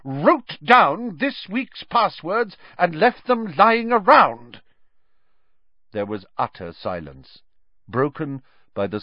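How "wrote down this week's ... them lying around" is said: high, slow, outraged demand; voice shaking. A narrator's reading. Professor demands the culprit from the crowd, voice shaking with anger. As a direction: draw out each word, building to loud fury. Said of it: "her voice shaking".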